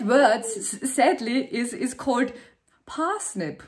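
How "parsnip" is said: parsnip said the British way, long open a, no German accent; a crisp British parsnip, soft at the end. Shown as a demonstration. Austrian woman showing her one British-sounding word, British accent. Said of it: "where I sound really British".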